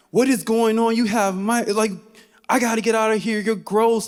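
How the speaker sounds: high-pitched, flustered imitation; mock freak-out; retold disgusted panic. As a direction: mimic a grossed-out date desperate to leave. Date's disgusted outburst, retold.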